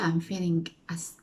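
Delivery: ordinary talk, nothing marked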